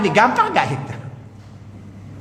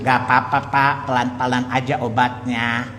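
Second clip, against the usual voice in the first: soothing doctor; 7 semitones lower than usual. Retold or imitated. Doctor placating a seriously ill patient, low and reassuring. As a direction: play down the illness to spare the patient's feelings. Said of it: "supaya pasiennya nggak tersinggung".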